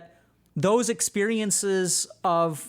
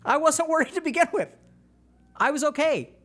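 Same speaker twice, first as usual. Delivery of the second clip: a hint of a chirpy, higher kid; unbothered kid; 8 semitones higher than usual. Kid telling the parent he was fine, lightly voiced. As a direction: shrug it off like a calm kid. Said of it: "And the kid was like".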